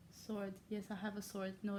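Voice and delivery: ordinary talk, nothing marked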